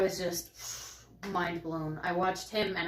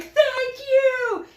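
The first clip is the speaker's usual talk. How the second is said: the runner's higher, louder thanks in passing; thank you; 9 dB louder than usual. Retold or imitated. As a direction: voice his thanks as he runs by. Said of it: "And he's like".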